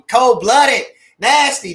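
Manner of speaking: excited shout. Real, not acted. Raving over Mosley's defense.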